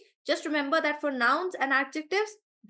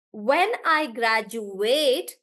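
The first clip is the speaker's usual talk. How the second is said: "graduate" slow, with a long ATE; verb stress; much slower than usual. Shown as a demonstration. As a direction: stretch the end of "graduate". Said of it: "this is the verb".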